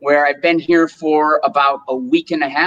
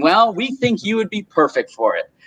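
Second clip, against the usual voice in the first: normal talking voice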